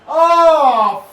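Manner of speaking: anguished shout. Real, not acted. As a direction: howl as the chance goes begging. Last chance missed, yelling.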